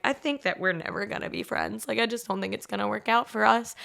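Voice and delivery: retelling the coffee talk, a soft firm dismissal; cool quiet brush-off. Retold or imitated. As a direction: drop the voice and shut her down calmly. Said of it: "And I was like".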